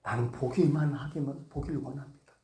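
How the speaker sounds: lightly acted humble answer, softening at the end; blind man answering. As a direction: state the wish quietly. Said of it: "맹인이 한마디 딱 하잖아요".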